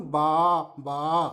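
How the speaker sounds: low, drawn-out baa; teacher imitating a sheep's baa. Shown as a demonstration. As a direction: bleat slowly like a sheep. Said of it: "शिप का sound".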